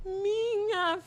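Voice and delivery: a mother thrilled to see her daughter; amazed mother. Retold or imitated. Mother amazed seeing daughter enter.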